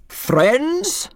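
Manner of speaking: loud, high roar; roared greeting. A narrator's reading. Preacher roaring at the damp congregation. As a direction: bellow it to the whole hall. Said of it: "Jack Garrity roared".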